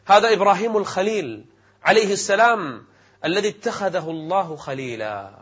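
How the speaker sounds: ordinary talk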